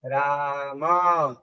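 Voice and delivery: राम stretched slowly as "raa-ma", low; correct pronunciation. Shown as a demonstration. Teacher joining the split letters, राम in slow syllables. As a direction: draw out राम, sounding the final a. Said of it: "सही उच्चारण क्या हुआ इसका".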